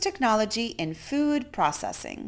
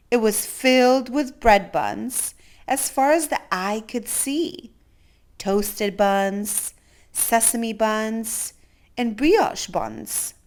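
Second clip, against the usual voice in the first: ordinary delivery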